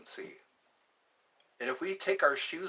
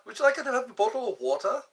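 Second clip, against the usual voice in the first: fast, high, louder mock British; hurried British accent, pitched way up; 8 semitones higher, 5 dB louder, faster than usual. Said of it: "I am British".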